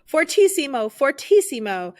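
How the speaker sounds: high, loud triumphant call; rapturous shout; ecstatic cry. Acted. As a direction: cry out at the peak of the music. Climax cry of the music.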